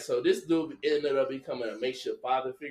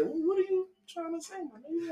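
high incredulous reply; 12 semitones higher, 6 dB quieter than usual. Retold or imitated. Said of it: "I said".

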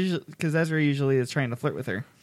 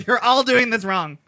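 bossy scolding; 8 semitones higher, 3 dB louder than usual. Retold or imitated. Quotes Hera scolding.

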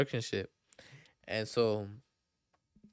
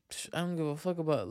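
ordinary talk

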